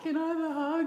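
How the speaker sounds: high, small pleading voice; mock needy whimper; needy plea. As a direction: ask for a hug like a weepy child. Asking husband for hug, hormonal.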